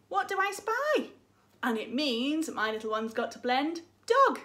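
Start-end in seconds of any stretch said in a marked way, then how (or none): 0.1-1.1 playful question. Shown as a demonstration. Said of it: "I'll say something like".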